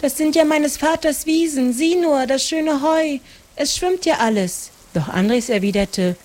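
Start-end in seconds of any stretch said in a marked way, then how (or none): 0.0-4.7 much higher, alarmed exclamation; dismayed cry. A narrator's reading. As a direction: cry out in shock at the flood. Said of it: "rief Maren".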